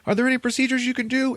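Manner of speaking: high-pitched frantic mock pleading; acted desperate pleading. She begs the auto dock for procedures, mock-desperate. Acts her pleading with medical machine.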